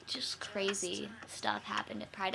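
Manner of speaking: slow breathy whisper; soft whispered summing-up; whispered aside. Real, not acted. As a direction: whisper it like a secret.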